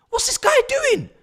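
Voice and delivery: high, loud outraged shout; disbelieving shout. Real, not acted. Disbelief at defender.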